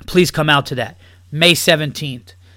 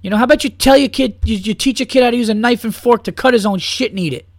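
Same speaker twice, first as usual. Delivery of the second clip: ordinary delivery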